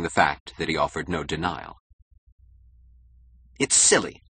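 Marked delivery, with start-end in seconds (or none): 3.6-4.2 higher-pitched, irritated outburst; angry snap. A narrator's reading. Keating rejects Toohey's plan, snapping angrily. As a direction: snap it dismissively. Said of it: "snapped Keating angrily".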